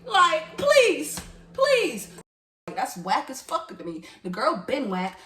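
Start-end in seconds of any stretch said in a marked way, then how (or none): 0.0-2.1 loud, high, separated pleas; raised-voice, fed-up interjection; exasperated please. Real, not acted. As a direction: throw out each please in exasperation. Exasperated outburst after a long pause.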